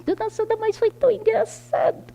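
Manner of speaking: high, light amused voice; mock amused tease; laughing remark. Friend teasing Dona Sandra, high and amused. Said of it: "ela dando risada assim".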